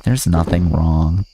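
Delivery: usual speaking voice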